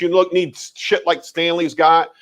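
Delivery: ordinary delivery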